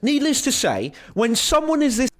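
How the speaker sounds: high-pitched, badly faked British accent. Quoting the critic Nathan Robinson in a mock British accent. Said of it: "I can't do it in a British accent".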